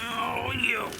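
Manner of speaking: soft high coo to the cat; tender cooing. Real, not acted. Owner cooing softly to his cat. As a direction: coo fondly at the pet.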